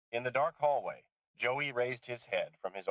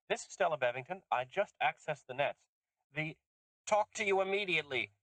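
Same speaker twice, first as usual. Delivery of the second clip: lightly voiced older woman, higher, urgent; urgent phone message; 10 semitones higher than usual. Susan leaving an urgent message for Lisha, hurried. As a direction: leave a hurried message on the line.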